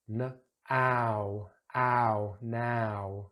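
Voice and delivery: ow sound isolated then in now. Shown as a demonstration. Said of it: "This is the ow".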